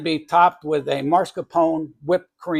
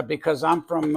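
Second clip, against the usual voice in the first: ordinary delivery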